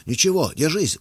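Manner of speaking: loud, high-pitched brusque encouragement; gruff retort. A narrator's reading. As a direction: brush off the complaint, loudly and brusquely. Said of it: "буркнул он".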